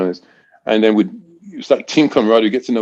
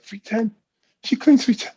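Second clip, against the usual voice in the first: much higher, incredulous echo of the number; astonished at the weight she lifts; stunned disbelief; 13 semitones higher, 4 dB quieter than usual. Retold or imitated. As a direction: replay the shock in your head. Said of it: "I went back in my mind".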